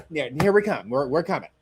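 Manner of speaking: normal talking voice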